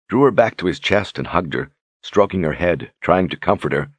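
plain reading voice

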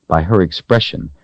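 ordinary delivery, nothing marked

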